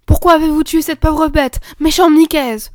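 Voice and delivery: put-on loud, high outraged girl; indignant. Camille confronting Nicaise over the dead hedgehog, indignant. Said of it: "dit Camille avec indignation".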